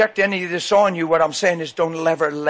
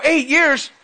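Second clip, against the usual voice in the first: mock disbelief; 8 semitones higher than usual. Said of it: "People go".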